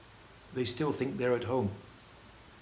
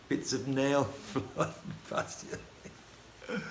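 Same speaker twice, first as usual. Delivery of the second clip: breaking into laughter mid-sentence; words dissolving into helpless laughs; laughing; 6 dB quieter, much slower than usual. Real, not acted. Speaker tickled by the toenail clippings on the bus, laughing. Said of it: "I'm laughing again".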